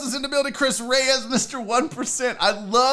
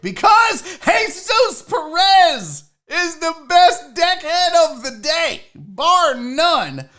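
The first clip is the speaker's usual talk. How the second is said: louder, higher, slow hype announcement; excited shout-out; 8 semitones higher, 7 dB louder, much slower than usual. Real, not acted. Hyping top chatter on stream.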